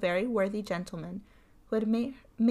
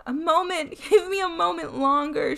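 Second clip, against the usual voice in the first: nervous plea; 8 semitones higher, 6 dB louder than usual. A narrator's reading. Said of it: "she answered".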